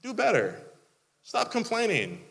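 fed-up plea to grumblers; frustrated scolding. Retold or imitated. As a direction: come on, do better. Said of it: "I get frustrated".

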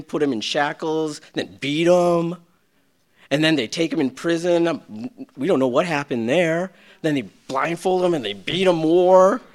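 ordinary talk

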